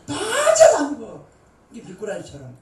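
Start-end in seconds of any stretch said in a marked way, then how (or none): 0.1-1.2 high, slow teasing drawl; laughing, teasing sing-song; playful teasing. Real, not acted. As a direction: tease the congregation member. Mocking slippery escape.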